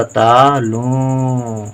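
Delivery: तालों stretched very slowly, syllable by syllable; drawn-out syllables. Shown as a demonstration. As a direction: dictation to pupils: teacher stretches तालों very slowly. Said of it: "ता में बड़े आ की मात्रा".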